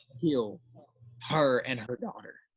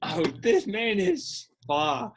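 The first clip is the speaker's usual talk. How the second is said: slow, high-pitched hype; drawn-out, amazed reaction; retold awe; 8 semitones higher, slower than usual. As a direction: savor it like a thrilled fan. Acting out awe in the theater.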